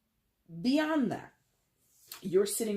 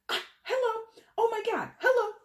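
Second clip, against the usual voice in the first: much higher, louder gushing hello; excited squeal; 6 dB louder than usual. Real, not acted. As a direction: burst out in sudden delight. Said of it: "Sorry, I got excited".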